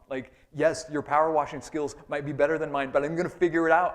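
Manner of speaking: loud, worked-up griping about the neighbor; annoyed homeowner rant. Acted. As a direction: complain loudly like you've had enough of his lawn advice. Voicing an annoyed neighbor's complaint.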